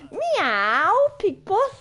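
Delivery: mock cat meow. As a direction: purr it out like a cheeky cat. Teasing catty meow.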